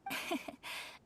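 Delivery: light breathy chuckle, mostly air; a short amused laugh under the breath; a polite laugh at his flattery; soft airy laugh. Acted.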